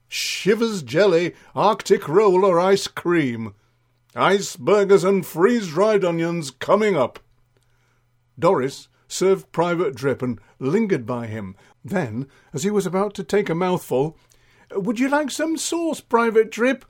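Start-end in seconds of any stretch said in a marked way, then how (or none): 0.1-7.2 dinner lady serving. Acted. 14.7-16.8 high-pitched eager offer; sing-song high voice of an attentive server; fussing Doris. Acted. Doris fussing over Private Drip.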